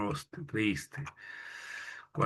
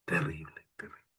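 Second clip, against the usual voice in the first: breathy whispered aside; 4 semitones lower, 7 dB quieter than usual. Real, not acted. Teacher whispering to the class after the sad poem. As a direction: breathe the word out, no voice.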